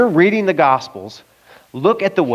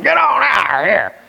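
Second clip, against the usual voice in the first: retold yell; 6 semitones higher than usual. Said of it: "yelling something I couldn't spell".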